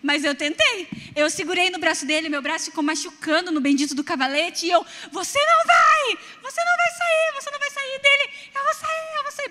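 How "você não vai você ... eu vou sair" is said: high desperate yell, pleading; shouting to stop father. Retold or imitated. Daughter shouting at angry father in the car, high desperate yell. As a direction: scream it high, holding him back.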